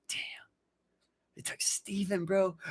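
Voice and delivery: soft, whispered lament; choked-up soldier mourning a friend, whispering; hushed grief. Retold or imitated. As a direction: let it fall to a near whisper. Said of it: "start getting emotional".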